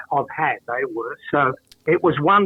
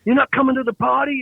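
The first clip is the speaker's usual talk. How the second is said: imitated waiter; 10 semitones higher, 3 dB louder than usual. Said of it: "And he said".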